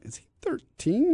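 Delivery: high, soft questioning voice; imitated puzzled listener. Listeners wondering about his age, high soft mock query. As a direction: pitch it up and ask softly, mock-wondering. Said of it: "some people listening are going".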